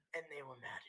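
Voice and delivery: noseless villain whisper; eerie unvoiced whisper. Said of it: "I don't have a nose".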